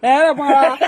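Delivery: loud, high cry of dismay; exasperated cry. Real, not acted. Man hears chicken-or-egg question return, exasperated cry. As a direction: wail out in fed-up protest.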